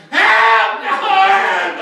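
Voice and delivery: very high mock scream for help; hammed-up panicked cry. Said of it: "I'm screaming, crying".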